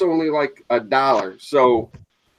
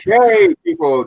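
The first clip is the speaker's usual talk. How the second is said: excited; 5 dB louder than usual. Real, not acted. Hyping the giveaway crowd.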